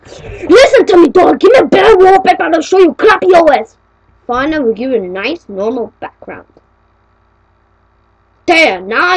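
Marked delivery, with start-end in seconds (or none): 0.1-3.8 loud, high, demanding voice; angry yell at computer. Pretend. User yelling at the Dora computer, loud and demanding.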